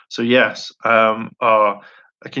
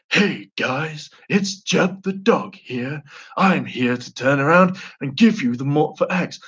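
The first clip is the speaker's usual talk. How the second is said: higher, sing-song cartoon dog voice; Jeb the Dog; 4 semitones higher than usual. Cartoon dog introducing himself to the guys, higher put-on voice. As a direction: playfully put on a drawn-out character greeting.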